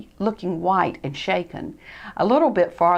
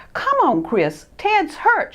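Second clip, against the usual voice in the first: higher, louder slow urging; urgent shout; 11 semitones higher, 3 dB louder, slower than usual. Acted. Freddie shouting at Christopher to hurry. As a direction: call him to hurry. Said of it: "shouted Freddie".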